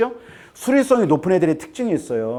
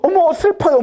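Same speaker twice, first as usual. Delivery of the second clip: high, loud gushing sob-story voice; mock weepy child; 13 semitones higher, 5 dB louder than usual. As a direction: ham up a child gushing over a sad drama. Mock emotional child watching drama.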